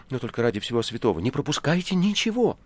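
quick, rising urgency; eager pleading. A narrator's reading. Guest wants every detail from Ivan, eager pleading. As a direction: beg hurriedly, building to a high finish.

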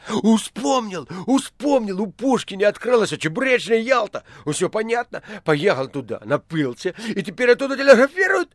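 high, loud eureka outburst; elated, high-pitched shouting; excited shout. A narrator's reading. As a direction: cry out the sudden realisation. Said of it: "возбуждённо кричал администратор".

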